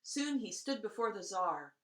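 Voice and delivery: ordinary delivery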